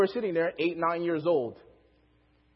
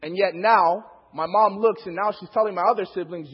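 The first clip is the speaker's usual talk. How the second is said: normal talking voice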